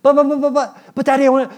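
put-on high, loud, frantic child babble; whiny kid. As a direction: mimic a kid begging hard. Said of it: "You hear my dad voice".

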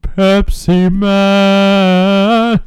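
loud, very slow jingle cry; Pepsi Man cry. Acted. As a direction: stretch it like a soda hero. Pepsi Man gag reply.

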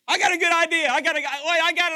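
high, loud, tumbling eagerness; overexcited Peter. Acted. Disoriented disciple has a good idea, overexcited shouting. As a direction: blurt it out, all worked up. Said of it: "Like Peter, he's all, he's out there".

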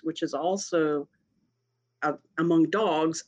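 usual speaking voice

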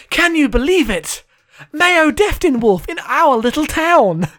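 put-on high, loud, slow awestruck child voice; excited children. Voicing the comic's children for his co-host, high awestruck kid voice. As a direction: gasp it out like a starstruck kid. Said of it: "and they say".

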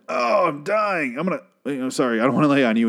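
hammed-up dying extra, pitched high; overacted death cry easing into politeness; mock death throes. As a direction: wail it melodramatically, then apologise in passing. Acts out extras staging their deaths.

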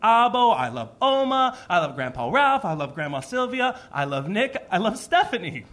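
lightly voiced little boy reciting; a hint of a small child's eager roll call; child's love list. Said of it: "when he started doing the".

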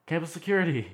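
high, loud exasperated answer; indignant. Retold or imitated. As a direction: squeal it back in outrage. Still indignant, answers the teasing question.

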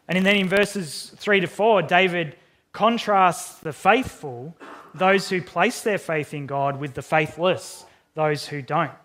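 usual speaking voice

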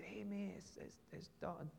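soft, hesitant whisper; hushed greeting. Retold or imitated. Greeting an old schoolfriend in church, hushed and hesitant. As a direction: greet him quietly, stumbling over the name.

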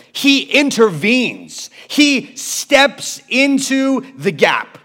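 loud, high-pitched sermon climax; impassioned pulpit proclamation; full-force preaching. Acted. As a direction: preach it out, building fervor.

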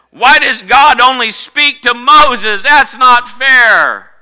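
slow, high, loud indignant whine; a sister protesting that it isn't fair, drawn out; Miriam complaining. Retold or imitated. Miriam complains God only speaks to Moses, indignant.

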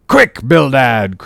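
excited captain calling out; urgent call. A narrator's reading. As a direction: summon him urgently. Peleg urging his partner.

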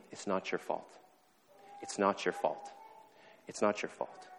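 soft, unhurried repetition; quiet, tender counselor's insistence; gentle reassurance. Retold or imitated. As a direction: repeat it gently, letting each one land. Counselor gently repeats reassurance in retold scene.

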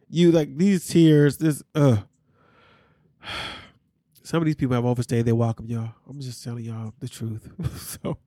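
ordinary delivery

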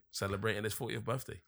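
ordinary delivery

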